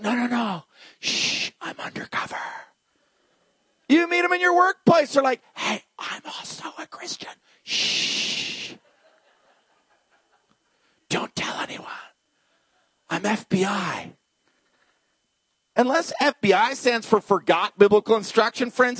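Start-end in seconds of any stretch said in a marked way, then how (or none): 0.0-2.4 hushed, furtive whisper with a shush; secretive whisper. Pretend. 5.5-14.1 hushed, furtive whisper with a shush; secretive whisper. Pretend.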